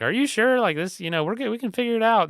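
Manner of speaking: retelling his dad's plea to stay at the campsite, in a hammed-up high voice; imitating his dad. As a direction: play dad pleading, squeaky and high. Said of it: "And he's like".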